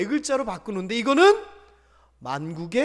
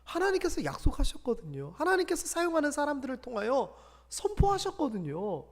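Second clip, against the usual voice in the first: ordinary talk, nothing marked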